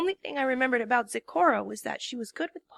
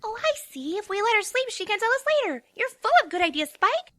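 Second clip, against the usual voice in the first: high, bright pony voice; sing-song cheerful realisation; high, upbeat filly; chipper Pinkie; 8 semitones higher, 4 dB louder than usual. A narrator's reading.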